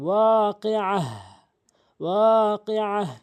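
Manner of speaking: ওয়াকিআহ ending in an audible h, not ta; final ha at pause. Shown as a demonstration. Teacher showing the pause form, ending on ha. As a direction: close ওয়াকিআহ on a breathy হা. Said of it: "হা বানাবেন".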